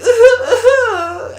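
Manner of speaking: very high, loud imitated sobbing wails with laughter; laughing parody of a cry; mock crying. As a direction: wail like a whiny anime hero, laughing. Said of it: "And then he cries".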